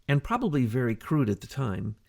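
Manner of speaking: ordinary talk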